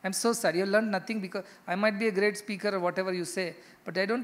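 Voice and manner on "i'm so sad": usual speaking voice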